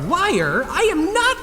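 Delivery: high, squeaky outraged protest; indignant denial. Acted. Cleaner denying he is a liar, high indignant squeak. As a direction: protest the accusation.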